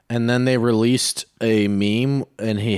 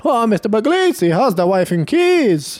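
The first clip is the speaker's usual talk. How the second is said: put-on sketch in a Mexican accent; 11 semitones higher, 5 dB louder than usual. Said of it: "Why is he Mexican?".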